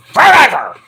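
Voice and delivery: hammed-up roar of devotion; champion's shout. Cheering own cat as champion.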